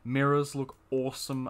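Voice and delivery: ordinary delivery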